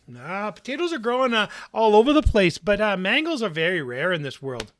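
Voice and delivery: haggling shopkeeper. Acted. Mango seller haggles with the player, high and dismissive. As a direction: brush off his offer.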